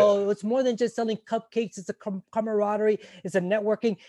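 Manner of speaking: mock the people who say this. Parodying chiefs who justify selling cupcakes, mocking. Mocking others' bake-sale excuses.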